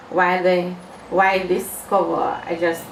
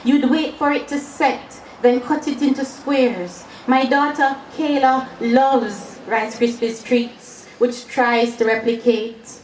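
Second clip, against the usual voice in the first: raised, rhythmic verse reading; poetry recital; 4 semitones higher than usual. Acted. Poet recalls a treat being made at the stove, poetry recital. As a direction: recite the steps as a fond memory in verse.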